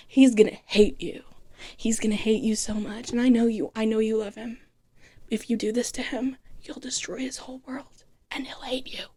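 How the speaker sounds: soft threatening whisper; hushed warning. Retold or imitated. Moira warns in hushed voice.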